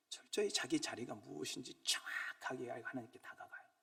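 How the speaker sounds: hushed, intimate sermon line; softly confiding to the congregation; whisper. Real, not acted. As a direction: lean in and whisper it.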